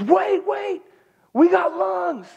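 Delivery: high, loud excited call; urgent shout. Retold or imitated. Doctor halting husband signing papers, urgent shout. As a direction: call out to stop him just in time.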